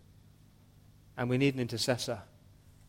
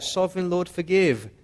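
raised, high-pitched cry to God; 5 semitones higher, 4 dB louder than usual. Acted. Prophet Amos begging God to forgive, crying out. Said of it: "cried out".

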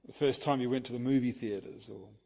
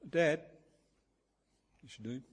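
put-on a child's small, soft call for Dad; 6 dB quieter than usual. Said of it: "in a small voice".

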